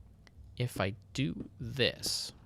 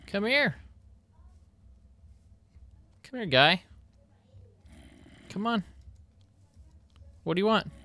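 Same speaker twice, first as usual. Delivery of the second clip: calling out; 11 semitones higher than usual. Real, not acted. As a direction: call the zombies over playfully. Luring zombies into trap.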